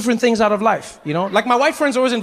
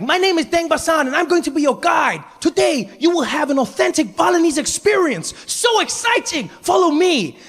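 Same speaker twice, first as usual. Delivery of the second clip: much higher, shouting guide welcome; overeager tour guide; 8 semitones higher than usual. Retold or imitated. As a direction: greet them like a way too happy guide. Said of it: "he's like".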